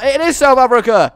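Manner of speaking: loud and high, indignant; exasperated outburst. Real, not acted. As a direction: throw up your hands at it. Exasperated at naming scheme.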